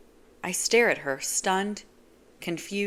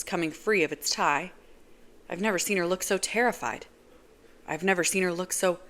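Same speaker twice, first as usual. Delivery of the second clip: plain reading voice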